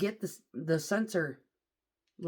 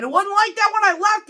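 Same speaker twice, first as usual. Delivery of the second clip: high, loud indignant insistence; loud protest; 15 dB louder than usual. Retold or imitated. Driver protesting to manager, high loud indignant. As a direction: defend yourself to the boss.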